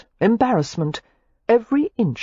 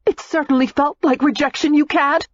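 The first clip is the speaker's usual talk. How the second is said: put-on higher, heated young woman's voice; Daisy, furious; 10 semitones higher than usual. A narrator's reading. Daisy snaps back at Matthew in fury. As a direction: hurl the accusation back at him.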